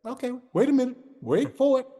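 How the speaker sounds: lightly voiced friend building suspense; imitating Steve. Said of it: "Steve was like".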